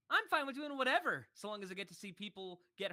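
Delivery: high, loud quoted character line. Retold or imitated. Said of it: "here's the fun one".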